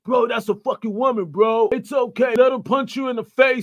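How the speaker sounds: high, loud mock plea on a woman's behalf; mocking her defenders. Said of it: "a lot of y'all would defend for this woman".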